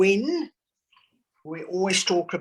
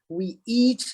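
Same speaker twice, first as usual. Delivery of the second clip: ordinary talk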